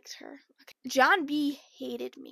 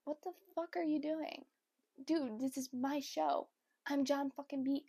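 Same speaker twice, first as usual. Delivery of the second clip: muttering confusion; 2 semitones higher, 8 dB quieter than usual. Retold or imitated. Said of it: "he's like".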